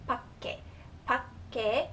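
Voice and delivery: "밖에" with a K sound closing the first syllable; careful "밖에", K sound in the middle; K sound mid-word. Shown as a demonstration. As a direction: model "밖에", stopping on K before the ending. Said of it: "pronounced with a K sound".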